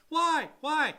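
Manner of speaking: high, loud mock protest; demanding agent. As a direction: echo the seller's outraged why as the agent. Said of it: "Seller's agent's like".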